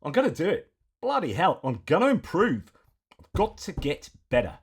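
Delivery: exaggerated Australian accent for a bit of fun. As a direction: play a cheerful mock Aussie with a big Australian twang. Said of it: "I know you don't like me doing this ac-, accent".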